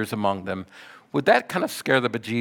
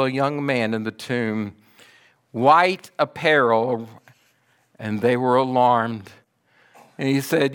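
ordinary delivery

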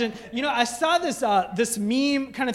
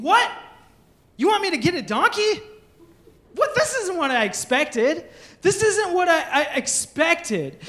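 higher, fast puzzled outburst; mock disbelief; 6 semitones higher than usual. Pastor voices a disciple let down by the donkey errand, higher and incredulous.

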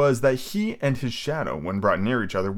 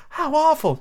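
put-on high girl voice; 4 dB louder than usual. Wendy voiced.

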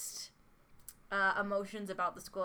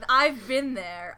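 louder, slow "I've been there" with weight on "I've"; emphatic agreement; 4 semitones higher, 9 dB louder, slower than usual. Real, not acted. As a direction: stretch out "I've been there" like a knowing sigh. Emphatic agreement, then repeated.

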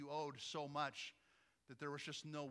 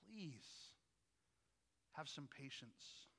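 soft, slow begging to the king; a quiet, drawn-out plea for mercy; pleading; 8 dB quieter, much slower than usual. Retold or imitated. Servant begging the king for patience, soft and slow pleading. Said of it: "he begs the king".

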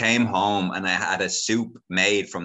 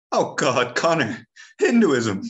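high, raised exasperated father voice; exasperated dad; 7 semitones higher, 5 dB louder, slower than usual. Retold or imitated. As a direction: voice the dad, pitched up and fed up. Dad voiced in retold family scene.

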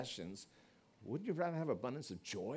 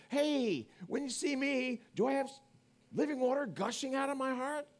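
calling out; 9 semitones higher, 5 dB louder than usual. Retold or imitated. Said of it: "ask your kids, ask your spouse".

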